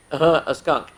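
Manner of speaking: ordinary delivery, nothing marked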